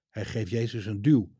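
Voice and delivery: ordinary delivery, nothing marked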